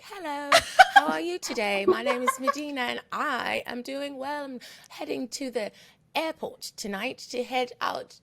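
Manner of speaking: light imitation of a British accent, laughing; a playful try at a posh British accent. To a British guest, a laughing attempt at a British accent. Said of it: "Since you have this accent".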